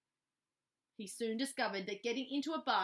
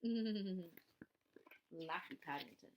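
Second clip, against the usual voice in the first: whispered aside; 4 semitones lower, 7 dB quieter, much slower than usual. Real, not acted. Narrator aside.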